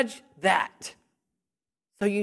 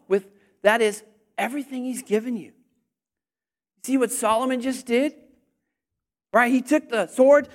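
normal talking voice

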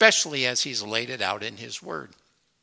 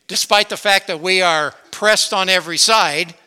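rising preaching; 5 semitones higher, 9 dB louder than usual. Real, not acted. Preaching build-up.

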